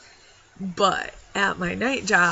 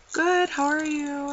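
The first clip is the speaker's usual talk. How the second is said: mock customer reply; 9 semitones higher, 3 dB louder than usual. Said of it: "And he was like".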